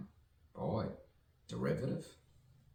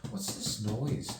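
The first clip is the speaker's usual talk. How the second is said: alarmed; 10 semitones higher, 3 dB louder than usual. Real, not acted. Hearing tapping noise, rising alarm.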